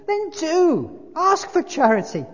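high, loud plea; urgent exhortation. A narrator's reading. Spirit answering the ghost, high urgent exhortation. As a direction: urge it out loudly.

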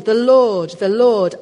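loud, booming proclamation of the Lord; proclaiming. Retold or imitated. God proclaiming his name to Moses, loud and solemn. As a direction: announce the name with weight. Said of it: "God proclaims his own name".

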